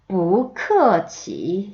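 뿌크어치 very slow, ending in a long rise; tone drill. Shown as a demonstration. Teacher modelling the whole phrase, 뿌크어치 slow and stretched. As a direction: model every syllable of 뿌크어치 slowly. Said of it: "한 번 더".

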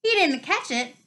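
high, rising outraged howl; howling protest. Acted. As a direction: protest it, voice climbing. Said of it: "Flint was still howling".

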